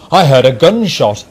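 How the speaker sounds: loud, urgent exclamation; exclaiming. A narrator's reading. Workman tells the police what happened, exclaiming. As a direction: blurt out the testimony loudly. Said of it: "exclaimed a workman".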